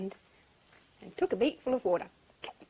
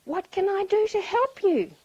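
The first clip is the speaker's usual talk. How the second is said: high, loud duck offering help; lifted, forceful character voice; concerned duck; 8 semitones higher, 8 dB louder than usual. A narrator's reading. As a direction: ask urgently as a helpful duck.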